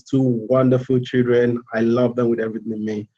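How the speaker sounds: usual speaking voice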